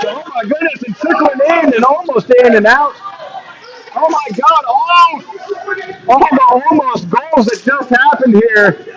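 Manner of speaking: high, amazed commentator call; excited disbelief. Real, not acted. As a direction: react to the scramble in disbelief. Near goals in live commentary.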